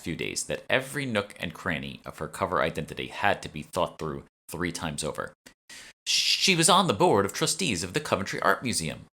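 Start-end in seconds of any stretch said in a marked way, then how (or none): 6.0-9.1 interjecting. A narrator's reading. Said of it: "I interjected".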